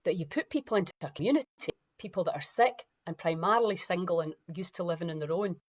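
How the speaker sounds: ordinary talk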